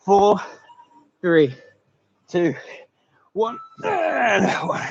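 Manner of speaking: a high, drawn-out push on the last rep; coach's shout. Real, not acted. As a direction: call the last rep out slowly, like a coach driving the class.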